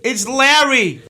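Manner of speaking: high, loud correction in an American voice; American guest snapping his own name; indignant loud American. Acted. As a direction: shout the name back, offended. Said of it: "He's a bit loud, isn't he?".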